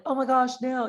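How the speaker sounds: high, bright oh my gosh; mock surprise. Seller on the phone, hammed-up high surprise. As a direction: play up the surprise.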